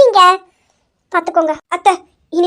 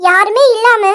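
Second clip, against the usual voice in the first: normal talking voice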